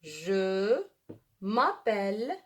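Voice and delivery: "je" drawn out slowly while writing; slow dictation. Shown as a demonstration. Teacher dictates slowly as she writes on the board. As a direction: stretch the word as you write it. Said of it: "je commence".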